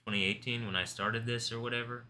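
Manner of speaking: normal talking voice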